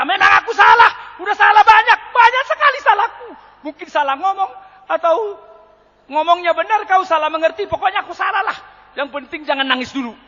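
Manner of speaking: loud, high, hurried-in admission of guilt; acted panicked apology. Husband desperate to stop wife crying, frantic apology.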